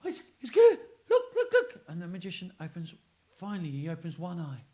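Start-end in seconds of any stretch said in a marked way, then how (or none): 0.0-1.8 high, loud and excited. Retold or imitated. Patient squealing at the magician's trick. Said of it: "he's like".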